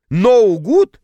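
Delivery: high, loud query with rising tune; questioning merchant. A narrator's reading. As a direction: ask back sharply, pitch jumping up. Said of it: "переспросил он".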